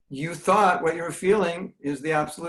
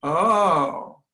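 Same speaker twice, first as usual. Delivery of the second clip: louder, drawn-out "oh" of dawning understanding; acted realisation; 2 semitones higher, 5 dB louder than usual. Devotee hears Bhakti Charaswami's point, sudden realisation. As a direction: act out the penny dropping. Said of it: "you go like".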